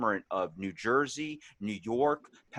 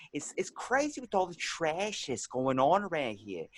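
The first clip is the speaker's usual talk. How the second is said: imitated New Jersey accent, pitched higher; 4 semitones higher, 4 dB quieter than usual. Imitating a Jersey visitor to town, mock Jersey accent. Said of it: "somebody from Jersey coming over here and talking".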